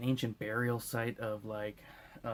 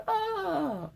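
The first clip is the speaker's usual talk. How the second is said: very high shrieking cry; mock screech. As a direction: screech like nails on a chalkboard. Nails on a chalkboard screech.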